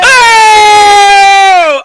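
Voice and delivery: loud high surprised cry; excited shout. Real, not acted. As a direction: yell out in delight.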